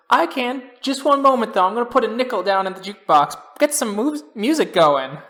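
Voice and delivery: high, loud, eager housewife voice; local housewife. Acted. Housewife volunteering at the bake sale meeting, piping up. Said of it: "One of the other local housewives goes".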